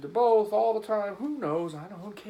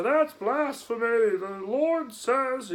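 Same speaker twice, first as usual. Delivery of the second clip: mock pious objector; 7 semitones higher, 6 dB louder, much slower than usual. Imagined religious objector.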